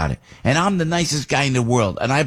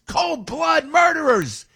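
shouted high and slow, every word hammered; cold blood murderers yelled in disgust; angry shout; slower than usual. Real, not acted. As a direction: spit out the words in outrage. Angry rant about killers.

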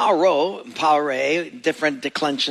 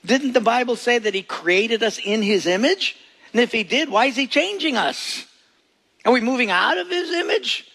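higher quizzical listener voice; higher, a puzzled church member objecting; questioning listener; 6 semitones higher than usual. Retold or imitated. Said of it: "you say".

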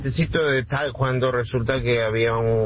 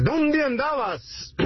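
much higher, slow scolding wife voice; a mock wife demanding where he's been; ¿Dónde andabas? drawn out high and slow like a mock angry wife; stern wife; 12 semitones higher, slower than usual. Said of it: "la esposa le habla en un tono bien fuerte".

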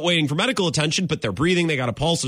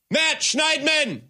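exasperated call-out; 9 semitones higher, much slower than usual. Real, not acted. Repeating name, slower.

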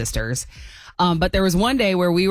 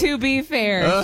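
drawn-out, high to be fair; slow mock defense; 6 semitones higher, much slower than usual. Real, not acted.

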